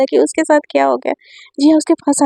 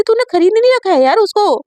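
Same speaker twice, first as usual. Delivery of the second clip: higher, heated scolding of a friend; angry outburst; 7 semitones higher than usual. Acted. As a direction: tell her off, pitch climbing. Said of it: "जिया एकदम से गुस्सा आ जाती".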